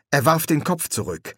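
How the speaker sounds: plain reading voice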